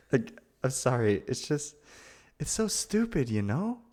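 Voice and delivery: usual speaking voice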